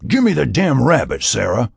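heated, forceful command; angry demand. Acted. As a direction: demand it back, temper rising. Mac demands rabbit during chase.

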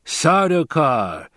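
high, slow, drawn-out name; musing. A narrator's reading. Fremen mulling over the enemy's identity, musing. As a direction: linger over the word in wonder. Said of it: "the Fremen mused".

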